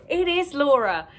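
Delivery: loud, high burst of triumph; delighted exclamation. Real, not acted. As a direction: cry out as your guess proves right. Finds the guessed gift sender.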